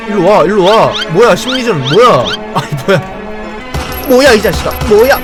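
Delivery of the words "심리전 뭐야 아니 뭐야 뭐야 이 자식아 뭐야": ordinary delivery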